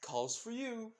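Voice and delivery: high, gleeful sing-song; devilish grin. Acted. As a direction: hand over trouble with relish. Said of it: "with a devilish grin".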